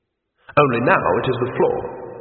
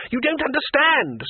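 very high, slow excited cry; excited scream; 14 semitones higher than usual. Acted. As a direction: scream over the wing beats in delight. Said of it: "screamed the tourist".

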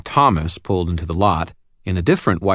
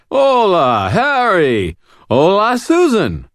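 put-on drunk voice; 14 semitones higher, 6 dB louder, slower than usual. A narrator's reading. Drunk Thomas greeting them.

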